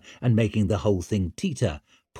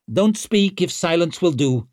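higher-pitched terse order to a companion; a touch of a grunt, cutting him off; grunted rebuke; 7 semitones higher, 4 dB louder than usual. A narrator's reading. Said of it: "grunted Vic".

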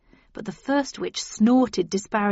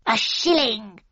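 witch voice. A narrator's reading. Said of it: "said the first".

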